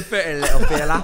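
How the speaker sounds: high, loud laughing British voice; laughing through a British accent; laughing mock British. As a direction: giggle it in a silly British voice.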